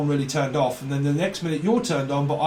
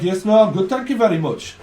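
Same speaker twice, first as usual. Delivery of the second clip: polite reply in a raised mock Brummie accent; 3 semitones higher, 5 dB louder than usual. Brummie answers the greeting, light mock Brummie accent. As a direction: answer kindly in a put-on Birmingham accent. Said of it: "it's Brummy".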